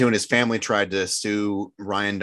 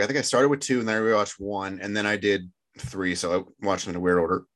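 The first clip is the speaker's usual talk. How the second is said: ordinary talk, nothing marked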